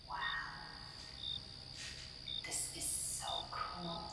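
ordinary delivery

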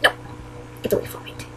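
ordinary delivery

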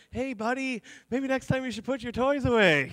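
high, sing-song parent voice; mock-sensitive dad. Dad to hurt son about his toys, mock-sensitive voice. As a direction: lift the pitch to coax a small child gently. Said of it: "trying to be sensitive about it".

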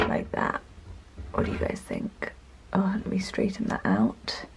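soft breathy aside; whispering. Real, not acted. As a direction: hush it to yourself. Whispering while arranging bags.

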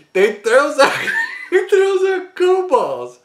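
cracking up mid-sentence; laughing. Real, not acted. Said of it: "I started laughing".

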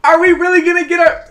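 high, loud incredulous question; can't believe it's happening; disbelieving. Real, not acted. Gamer asking in disbelief about the four-piece.

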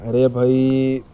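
slow, dejected groan; gloomy sigh. Acted. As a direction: sigh out heartbreak to a friend. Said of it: "बहुत एकदम उदास दिख रहा".

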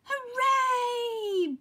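high, loud bee cheer; Honeybee. A narrator's reading. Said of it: "buzzed Honeybee".

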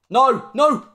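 high, loud alarmed cry; excited shout. Real, not acted. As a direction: shout in panic then relief. Last-ditch tackle.

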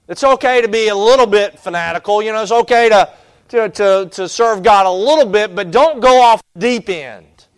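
loud, high, mocking voice of worldly friends. Worldly friends scolding the believer, loud and mocking.

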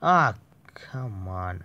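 ordinary talk

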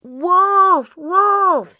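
loud, high false alarm scream; boy faking panic for the villagers. A narrator's reading. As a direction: scream wolf at the top of his lungs. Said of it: "he screamed".

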